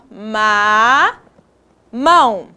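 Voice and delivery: "Ma" held long, then separate "mão"; syllable by syllable. Shown as a demonstration. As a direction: sound out "Ma, mão" with a gap. Teacher repeating the syllables.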